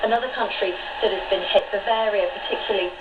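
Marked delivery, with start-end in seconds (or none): none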